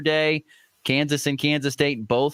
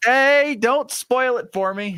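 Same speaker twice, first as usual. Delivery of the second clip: playful protest; 11 semitones higher, 3 dB louder, slower than usual. Real, not acted. Host playfully cuts off spoiler.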